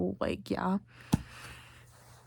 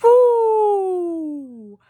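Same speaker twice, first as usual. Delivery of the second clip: loud, high, drawn-out whoo, slowly falling; long high "ooh"; 10 semitones higher, 6 dB louder than usual. Real, not acted. Overcome after reading the sad letter, long high whoo.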